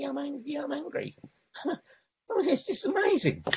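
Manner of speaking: high, light voice with a laugh; amused amazement. Real, not acted. Speaker tells the fellowship that admitting anger works, amused and amazed. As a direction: marvel at how easy it is, high-pitched.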